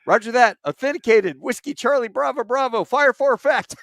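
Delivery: high, quick, crisp radio reply; brisk radio operator. Retold or imitated. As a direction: snap off the codes like a pro. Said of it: "the guy goes".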